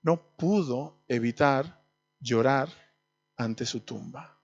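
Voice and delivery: soft slow ending at the tomb; hushed tender close. Real, not acted. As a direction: let the words sink down gently. Tender conclusion, fading.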